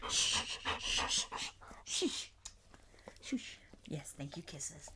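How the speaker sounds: soft whispered shh to calm the dog; whispered shushing. Real, not acted. Owner hushes the panting dog with soft whispers. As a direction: hush him gently in a whisper.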